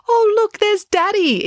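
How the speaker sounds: very high, loud delighted call; excited exclamation. A narrator's reading. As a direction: squeal it out on spotting a parent. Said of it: "exclaimed Loretta".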